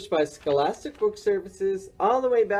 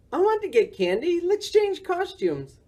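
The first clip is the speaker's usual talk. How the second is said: pleading bear cub; 14 semitones higher than usual. A narrator's reading. Boris begs his friend to swap costumes, whining. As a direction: beg for candy like a small child. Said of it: "Boris cried".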